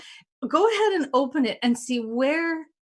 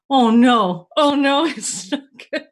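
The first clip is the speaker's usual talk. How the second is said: mock dread; 2 semitones higher, 8 dB louder than usual. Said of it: "didn't hear back for a week".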